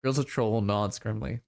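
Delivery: ordinary talk, nothing marked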